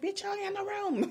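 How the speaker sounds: high-pitched, exasperated comeback; exasperated retort. Retold or imitated. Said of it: "I'm like".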